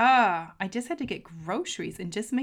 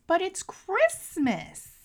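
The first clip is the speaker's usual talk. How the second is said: high, slow, drawn-out plea; whiny friend; 12 semitones higher, 5 dB louder, much slower than usual. Retold or imitated. Friend in the car explaining herself, high and slow. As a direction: stretch it out like a friend who can't see the problem. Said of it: "And her response is".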